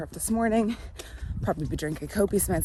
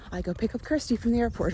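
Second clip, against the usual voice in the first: ordinary talk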